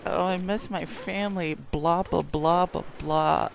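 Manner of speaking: high mock whiny sister voice with blahs; mocking big sister. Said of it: "she's always like".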